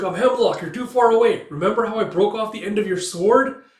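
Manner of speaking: high, loud gloating call; taunting shout. Retold or imitated. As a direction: shout the taunt across the field. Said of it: "He's like".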